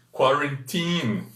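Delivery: put-on quarantine said slowly, kwa-ren-TEEN; careful slow quarantine with English vowels. Shown as a demonstration. Teacher modelling quarantine slowly for the live audience. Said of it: "Quarentena em inglês".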